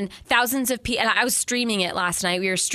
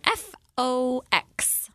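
put-on slow, higher little-kid voice; little boy spelling; 5 semitones higher, much slower than usual. Podcaster imitating movie's little boy spelling his surname, slow child voice. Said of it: "the little boy is spelling".

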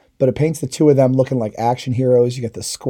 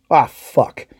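sudden dismay; 3 semitones higher, 5 dB louder than usual. Retold or imitated. Assaultman realizing he left his shotgun by the charge, dismayed outburst. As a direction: catch the mistake mid-breath.

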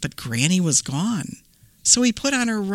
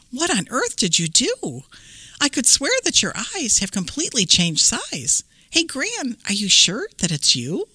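put-on higher, puzzled young girl; little girl voice; 6 semitones higher than usual. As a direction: ask like a wary little girl. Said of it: "Little Red took a look at her granny and said".

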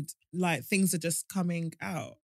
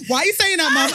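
loud, higher mock protest; shocked outburst; 7 semitones higher, 9 dB louder, faster than usual. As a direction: act out your own alarmed reply. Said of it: "And then I was like".

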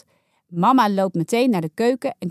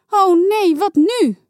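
high, loud worried cry; panicked; 10 semitones higher, 6 dB louder, slower than usual. A narrator's reading. Saar panics over slime in her hair, high and loud. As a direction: sound lost and alarmed.

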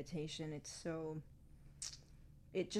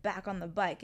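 normal talking voice

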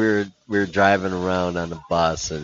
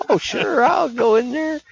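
much higher put-on voice; mock eager player, pitched high; teammate voice. Teammate voiced in retold story.

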